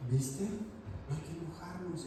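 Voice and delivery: soft, slow soothing mother; calm mother. Retold or imitated. Mother calming the father after the fight, soft and slow. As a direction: settle it down gently. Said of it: "Y la mamá".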